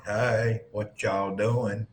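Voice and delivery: slow, lazy country drawl on "what y'all doing?"; imitating a rural Southern drawl; country Southern accent. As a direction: drag out the words like a rural Texan. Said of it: "'Cause you're not in a hurry".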